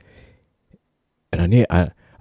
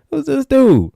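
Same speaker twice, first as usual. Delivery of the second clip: high, loud disbelieving question; baffled; 3 dB louder, slower than usual. Retold or imitated. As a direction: squeak out your confusion. Crew reacts to stranger.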